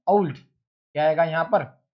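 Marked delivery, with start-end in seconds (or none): 0.1-0.4 ओल्ड with an au glide, like aul-d; correct diphthong. Shown as a demonstration. Teacher modelling the correct ओल्ड with an au glide. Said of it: "ओ में भी जो है वो औ का आता है".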